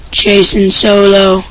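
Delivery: ordinary delivery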